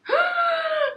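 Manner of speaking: a sharp gasp of sheer delight, way up high; high shrieking gasp. Real, not acted. Booktuber dreaming of a pink bookcase, high delighted gasp. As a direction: gasp like the best idea ever struck.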